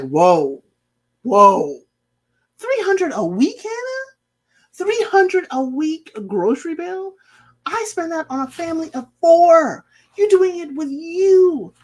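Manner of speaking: floored by the price; reacting to Hannah's grocery bill, shocked and loud; shocked disbelief.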